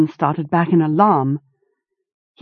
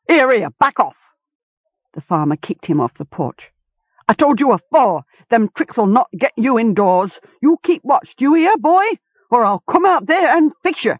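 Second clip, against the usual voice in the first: angry farmer; 6 semitones higher than usual. A narrator's reading. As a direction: drive the dog off with threats. Farmer kicks and threatens dog.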